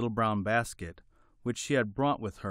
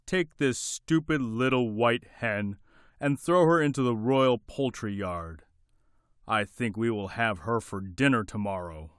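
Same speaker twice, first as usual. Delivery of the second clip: angry king order; 4 dB louder than usual. Acted.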